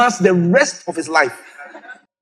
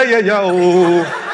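ordinary talk

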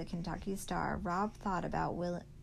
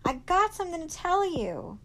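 high, loud urgent call; shouting; 12 semitones higher, 7 dB louder than usual. Acted. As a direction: shout it to her down the road. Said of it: "he shouted".

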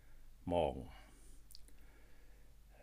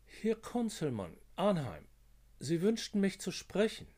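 Konzelmann, pitched higher, smooth; a higher, polished officer on the phone; higher-voiced officer; 11 semitones higher, 5 dB louder than usual. Narrator voices caller Konzelmann.

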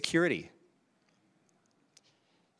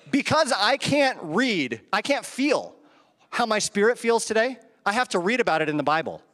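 usual speaking voice

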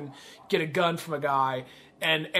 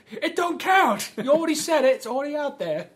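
high, aggrieved retort; mock indignant; 8 semitones higher than usual. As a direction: imitate someone refusing a retraction. Acted quarrel from film.

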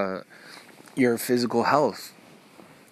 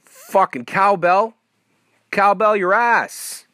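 higher, louder, slow exaggerated cowbell; slow mock yell; 8 semitones higher, 5 dB louder, slower than usual. Real, not acted. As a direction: belt it out slowly at the noise. Mock outburst at a sound.

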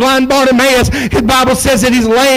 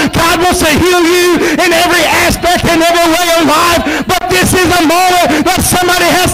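rising preacher's cadence, building to a cry; fervent revival preaching; 4 semitones higher than usual. Acted. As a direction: preach it, climbing to full force.

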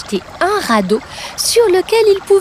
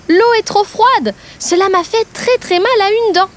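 higher, whiny voice of a crocodile with toothache; hurting crocodile; 6 semitones higher than usual. Acted. Crocodile telling the giraffe his tooth hurts, whining. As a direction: complain about the sore tooth. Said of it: "le cri de Drillo".

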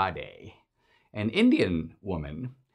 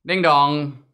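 mock doorbell; 5 semitones higher, 7 dB louder than usual. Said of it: "imaginative rendition".

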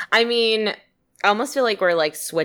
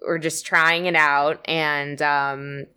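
ordinary delivery

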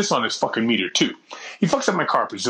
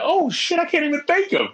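high surprised exclaim; 13 semitones higher than usual. Retold or imitated. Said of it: "I was like".

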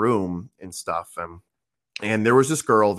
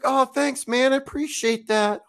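put-on high girl voice; 13 semitones higher than usual. Girl voiced in retold story.

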